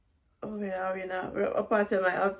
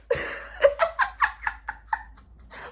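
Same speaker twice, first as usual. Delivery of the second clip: high laugh; 6 dB louder than usual. Real, not acted. Laughing at own story.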